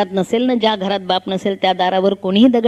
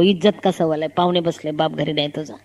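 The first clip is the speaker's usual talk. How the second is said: mother's hushed warning, dropping softer; softer and lower scolding hiss; 5 semitones lower, 5 dB quieter than usual. Retold or imitated. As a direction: shush the child so guests won't hear. Mother hushing child before guests.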